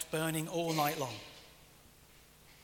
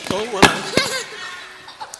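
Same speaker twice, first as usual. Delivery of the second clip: high surprised laughing oh hello; startled laugh; 10 semitones higher, 8 dB louder, much slower than usual. Real, not acted. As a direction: react to a sudden noise. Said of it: "Snored so loud that the sound system got broken".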